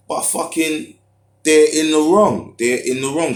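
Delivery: shouted high and loud, slowed down; furious shout, real; angry outburst. Real, not acted. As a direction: let the anger burst out as you say they're in the wrong. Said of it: "I'm actually getting angry".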